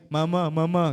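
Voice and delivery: matured, ordinary-pitched call; grown-up voice. Shown as a demonstration. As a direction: say it in a grown person's register. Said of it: "Oh, paglaki".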